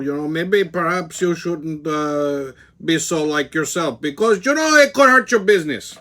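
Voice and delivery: lightly hammed Puerto Rican accent, rising to a squeal at the end; imagined Puerto Rican accent. Imitating his friend on the phone in a Puerto Rican accent. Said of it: "imagine she's got a Puerto Rican accent".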